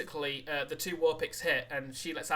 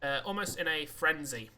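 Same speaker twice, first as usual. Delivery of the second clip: ordinary talk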